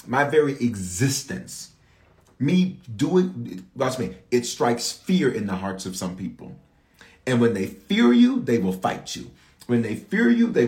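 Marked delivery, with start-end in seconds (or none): none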